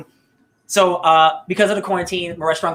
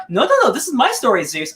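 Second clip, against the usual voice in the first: lightly hammed Greek accent, high and protesting; a hint of a Greek accent, pitched up in protest; 8 semitones higher than usual. Prometheus scolds Zeus over his story, in a light Greek accent. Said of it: "I took on a Greek sa-, accent for no reason".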